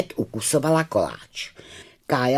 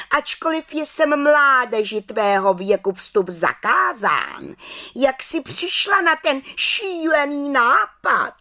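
stern head witch; 10 semitones higher, 4 dB louder than usual. Acted. Head witch scolds trespasser.

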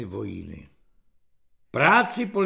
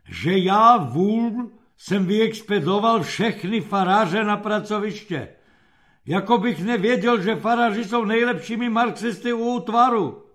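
raised, exasperated self-reproach; angry grumbling; 5 semitones higher, 5 dB louder than usual. A narrator's reading. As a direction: rage at your own stupidity, pitched up. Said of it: "zahučel zlostně". (zlostné, rozčilené nadávání)

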